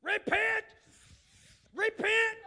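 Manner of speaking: sudden loud high cry of Repent; shouted repent. Shown as a demonstration. Preacher shouting to the congregation, a loud high cry. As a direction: bellow the word like a call to the sinner.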